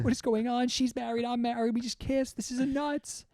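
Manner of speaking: high, fretful freak-out voice; mock panic. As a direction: play a cheater freaking out in a high voice. Acts out married coworkers' panic after kissing.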